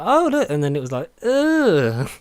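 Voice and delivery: mock shock. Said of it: "I was like".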